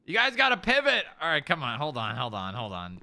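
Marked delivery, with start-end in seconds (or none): 0.1-1.1 raised command. Real, not acted. 1.8-3.0 quieting down. Real, not acted. Fading, quieter.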